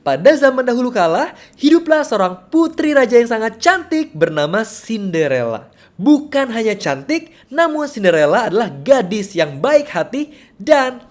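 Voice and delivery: high, loud, swooping storyteller intonation; animated fairy-tale storytelling. Shown as a demonstration. Teacher demonstrating Cinderella for small children, animated storytelling. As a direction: tell it vividly to hold a small child's attention. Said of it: "aku mau mendongeng mengenai Cinderella".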